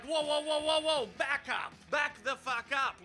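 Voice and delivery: comic animal sounding the alarm; alarmed sea lion. Acted. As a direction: warn them off in a panicky high voice. Sea lion protests at humans fishing.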